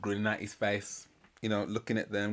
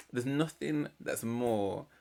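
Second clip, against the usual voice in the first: ordinary talk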